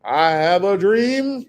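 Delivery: loud, high, drawn-out orator's proclamation; swelling, hammed-up speechifying; mock oratory. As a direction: mimic a grand civil-rights speech, stretched out slow. Mocking famous speech after spirituals.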